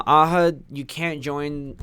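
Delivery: ordinary talk, nothing marked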